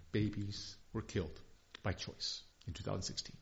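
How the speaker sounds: soft, low, nearly whispered; hushed. Real, not acted. Pastor telling congregation abortion numbers, hushed. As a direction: drop to a grave hush. Grave statistic.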